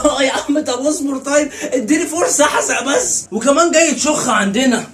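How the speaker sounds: high, loud, slow straining; comic straining; straining groans. Acted. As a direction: push and groan through it. Straining on the toilet.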